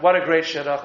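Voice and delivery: ordinary delivery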